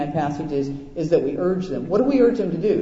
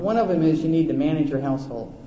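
normal talking voice

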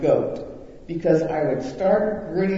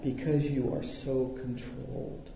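soft coworker reply; 6 semitones lower, 11 dB quieter than usual. Retold or imitated. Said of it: "How do you know I'm mad".

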